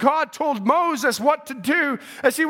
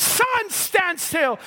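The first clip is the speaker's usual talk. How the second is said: high, very slow, stretched-out command; commanding cry; 3 semitones higher, much slower than usual. Acted. Joshua commanding the sun, high drawn-out cry. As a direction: cry it out slowly to the heavens. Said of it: "something on the inside well up says".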